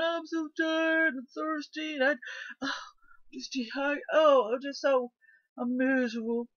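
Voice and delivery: hammed-up crying about being thirsty; mock crying. Two thirsty hikers whining to the others, mock crying. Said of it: "here's two of 'em crying".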